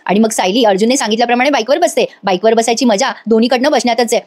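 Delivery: ordinary talk, nothing marked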